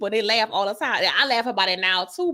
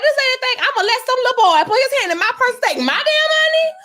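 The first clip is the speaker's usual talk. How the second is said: much higher, louder, outraged disbelief; indignant outrage; 14 semitones higher, 7 dB louder than usual. Retold or imitated. Retelling to co-hosts, young self offended at grandma. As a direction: play your younger self fuming at grandma. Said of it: "I was like".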